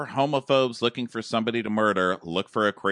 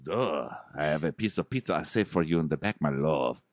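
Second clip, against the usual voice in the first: low, quick dopey lover voice; mock low-voiced devoted boyfriend; Jorge; 7 semitones lower, 5 dB quieter than usual. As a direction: drop low and softer for a dim sweetheart impression. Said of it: "Jorge's like".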